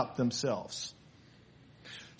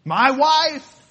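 louder, pointed "My husband. My wife."; imitating an annoyed spouse; mock irritated spouse; 8 dB louder, slower than usual. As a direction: spit out the title like you're fed up. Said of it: "when you're mad at your husband".

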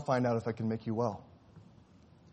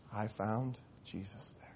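much softer, hushed words through tears; tearful; 3 semitones lower, 10 dB quieter than usual. Acted. Weeping doctor answers the dying boy softly. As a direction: let it come out choked and quiet. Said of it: "with tears".

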